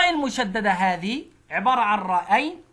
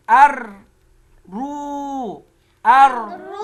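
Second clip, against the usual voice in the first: أر punched loud, رو higher; loud syllable drill; 4 semitones higher, 7 dB louder, much slower than usual. Shown as a demonstration. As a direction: beat out أر رو clearly. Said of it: "بيجي عندي مقطعين".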